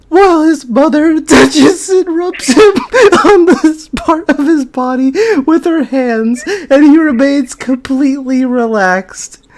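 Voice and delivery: words squeezed out through giggles; trying to read but breaking up; laughing while reading. Real, not acted. Reading the fanfic to her co-host, laughing through it.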